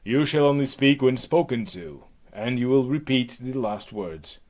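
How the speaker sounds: loud theatrical decree; commanding Hera. Acted. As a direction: lay down the sentence as a goddess. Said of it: "So, please, back to Hera".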